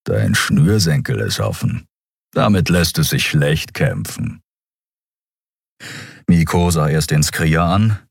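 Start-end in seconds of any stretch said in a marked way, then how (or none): 0.0-4.4 low rumbling creature voice; grumbled remark in a giant's voice; growling giant. A narrator's reading. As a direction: rumble it like a hulking brute. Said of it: "und brummte".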